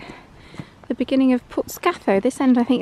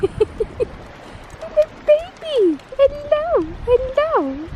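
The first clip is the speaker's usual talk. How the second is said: cooing greeting; 4 dB louder, much slower than usual. Real, not acted. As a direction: coo a high-pitched hello to a little one. Greeting a baby or pet.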